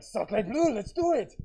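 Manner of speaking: cartoon French accent, loud and high; mock French accent. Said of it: "this French guy, he's like".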